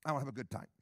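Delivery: ordinary talk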